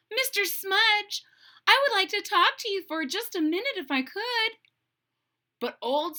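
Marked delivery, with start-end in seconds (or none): 0.1-4.6 polite heroine voice. Acted. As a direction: ask nicely, very proper. Serendipity politely addresses Smudge.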